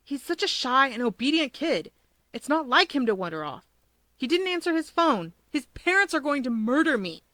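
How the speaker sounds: miserable, panicking friend. A narrator's reading. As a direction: fret out loud, close to despair. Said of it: "Kudo sounded miserable".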